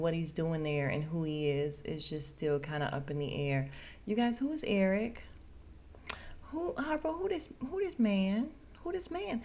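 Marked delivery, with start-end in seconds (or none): 4.0-9.4 pitched way up, demanding who this man; incredulous high who this man. Real, not acted. Vlogger asking viewers about the stranger, incredulous and high-pitched. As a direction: ask it like you can't believe he's there.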